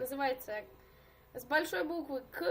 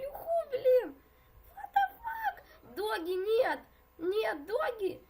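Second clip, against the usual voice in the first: panicked yelling; 6 semitones higher, 6 dB louder, slower than usual. Real, not acted. About to be eaten in game.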